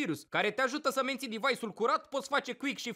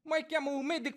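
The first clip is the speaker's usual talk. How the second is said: normal talking voice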